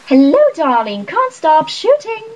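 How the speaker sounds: high, sing-song trill, slowing at the end; mother trilling a busy greeting. A narrator's reading. Mother greeting Bridget on set, trilling high. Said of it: "trilled my mother".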